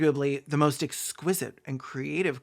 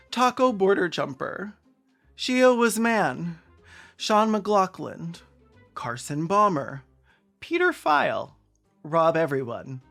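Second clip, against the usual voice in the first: high, drawn-out proclaiming of names; mock roll-call announcement; 5 semitones higher, slower than usual. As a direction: announce each name grandly, with a pause after. Reads out list of joke names.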